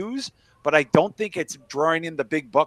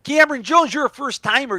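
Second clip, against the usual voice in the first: put-on a much higher, excited Chicago-accent welcome; 9 semitones higher, 5 dB louder than usual. Host welcoming a first-time viewer, in a Chicago accent. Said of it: "stop the accent".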